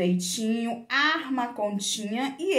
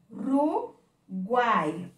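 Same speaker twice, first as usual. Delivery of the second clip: URUGUAI said slowly syllable by syllable, U, RU, GUAI; teacher slowly separating Uruguai with clear pauses between syllables; split into syllables; much slower than usual. Shown as a demonstration. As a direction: break Uruguai into three separate beats, U - RU - GUAI. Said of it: "Se eu for separar essa palavrinha".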